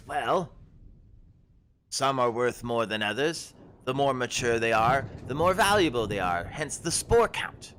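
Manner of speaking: a startled forager shooing travellers back; herb-picking woman. Acted. GM voices Ashleen.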